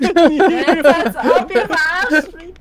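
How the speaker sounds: yelling through laughter; loud laughing yell. Real, not acted. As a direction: shout it half laughing. Yelling at teammates mid-game.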